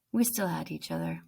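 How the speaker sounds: plain reading voice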